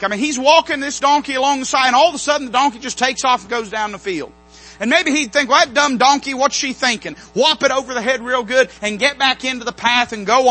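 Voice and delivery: loud, driving sermon delivery; preaching at full force. Acted. Preacher telling church about Balaam, loud and fervent. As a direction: build the story with rising fervour.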